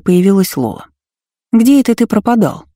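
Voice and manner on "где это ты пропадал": higher, projected stagey voice; well-trained stage voice. A narrator's reading. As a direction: declaim it like an actress. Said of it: "воскликнула она хорошо поставленным голосом".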